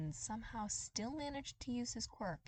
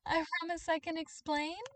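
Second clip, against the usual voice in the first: giggly; 10 semitones higher, 6 dB louder than usual. Acted. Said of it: "broke out into hysterical giggles".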